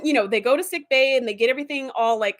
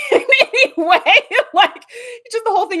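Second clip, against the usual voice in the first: laughing; 11 semitones higher, 7 dB louder than usual. Real, not acted. Laughs heard on lines.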